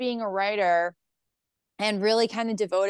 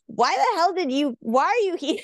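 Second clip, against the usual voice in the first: higher-pitched mock bafflement; perplexed; 8 semitones higher, 3 dB louder than usual. Host to a panicking first-time performer, mock perplexed. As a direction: imitate an incredulous host, pitched up. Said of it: "like, perplexed".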